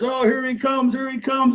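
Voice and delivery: high, loud excited cry; high-pitched birds shouting here he comes; excited watchers. Acted. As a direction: cheer as he comes down. Birds watch Raven fall.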